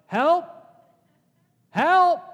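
high, loud holler for help; two big rising yells; imitated shout. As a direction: shout it out like a man locked in a cell. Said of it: "is to begin to shout".

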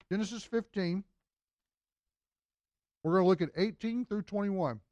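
words round a bite of donut; chewing while talking. Real, not acted. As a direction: talk through a mouthful of donut. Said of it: "trying to eat donuts at the same time".

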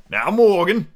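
deliberate pirate questioning; very slowly. Acted. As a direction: address the sailor with slow menace. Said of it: "said Long John very slowly".

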